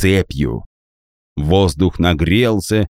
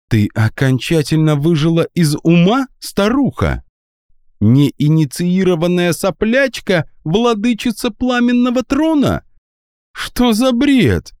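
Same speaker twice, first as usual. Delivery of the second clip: high, mocking outrage; scornful disbelief; 10 semitones higher than usual. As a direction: sneer at the old woman's madness. Alisia scoffs at old lady. (презрительное возмущение)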